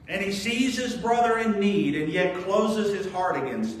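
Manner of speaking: loud, high, driving sermon delivery; full-force preaching. Acted. Pastor urging his church, loud, high preaching. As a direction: preach it hard to the congregation.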